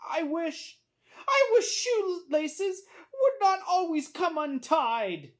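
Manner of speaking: high-pitched, drawn-out lament; woeful little boy.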